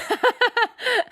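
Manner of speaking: high squealing laugh. Real, not acted. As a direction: burst of high giggling. Laughs after giving blanket.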